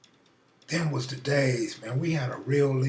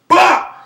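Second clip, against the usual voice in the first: angry shout; 15 dB louder than usual. Retold or imitated. Flipping board, catching cheater.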